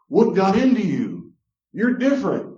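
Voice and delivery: high, loud, startled spouse reply; shocked spouse. Retold or imitated. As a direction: gasp it out like a stunned husband.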